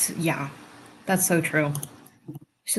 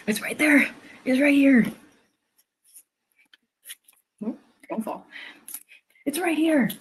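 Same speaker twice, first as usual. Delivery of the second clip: high sing-song pet voice; pet talk; 8 semitones higher than usual. Real, not acted. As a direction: coax the dog in a high voice.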